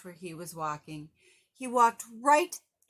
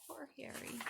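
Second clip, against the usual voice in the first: much softer, tender pity; soft aside; 5 semitones higher, 17 dB quieter than usual. Real, not acted. Reader aside to listening child.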